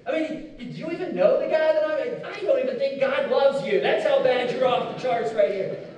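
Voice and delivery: loud, high, scornful rebuke; slowing into a loud sneer at the end; judgmental scolding. Acted. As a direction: lay into them like a self-righteous church member. Mocking a judgmental churchgoer.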